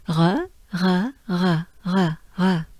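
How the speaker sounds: "re" with a French throat R; short repeated "re", R made in the throat; French R sound. Shown as a demonstration. Teacher drills next sound for learners, French R sound. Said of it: "Next we have".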